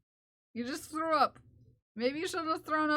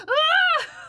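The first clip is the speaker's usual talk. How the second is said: mock shriek, much higher and louder; imitated startled scream; 10 dB louder than usual. Coworker startled by cat on video call, mock shriek. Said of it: "Brenda's like".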